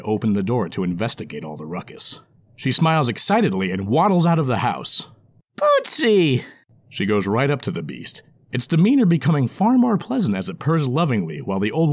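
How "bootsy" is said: put-on high, joyful old lady cry; delighted old woman. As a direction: greet the lost pet with joy.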